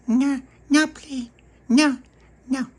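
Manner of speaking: put-on high squeaky toddler protest; a tiny child saying no, high up; baby voice. Narrator voicing toddler Baby Lucy refusing, high baby voice.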